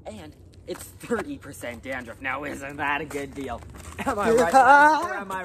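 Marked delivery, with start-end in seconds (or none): 4.1-5.4 hammy call-out. Acted. Hammy sales pitch to crowd.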